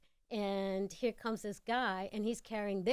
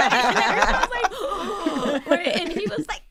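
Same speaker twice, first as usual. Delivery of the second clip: laughing; 8 semitones higher, 13 dB louder than usual. Real, not acted. Laughing while narrating.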